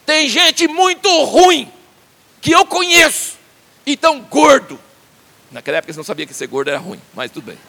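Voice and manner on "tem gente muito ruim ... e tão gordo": high, loud, slow bitter lament; Job's bitter complaint. Retold or imitated. Job complaining to his friends about wicked men, loud and high. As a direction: drag out the complaint in high anguish. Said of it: "falar assim, ó".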